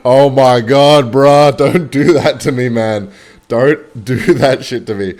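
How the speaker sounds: loud, slow, laughing relief after a scare; half-laugh, half-groan at the guard; relieved laughing protest. Retold or imitated. Telling the fan guard off after the scare, loud and laughing.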